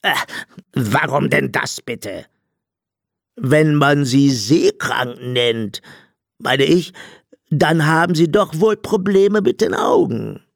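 slow, put-on robber voice rising in pitch; hesitant, drawn-out character voice; puzzled blind robber. A narrator's reading. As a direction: reason it out slowly, baffled. Blind robber questions the captain.

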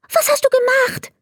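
a star panicking in the dark; agitated. Acted. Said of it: "fragte er ganz aufgeregt".

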